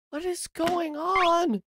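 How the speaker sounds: very high, slow, drawn-out wail; slow high-pitched disbelief; utter bewilderment. Real, not acted. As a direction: stretch it out high, lost for words. Bewildered by the game.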